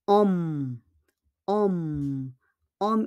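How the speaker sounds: "om" starting straight on the vowel, no H; silent H. Shown as a demonstration. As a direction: draw out "Om" with a long humming M. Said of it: "We don't pronounce the H".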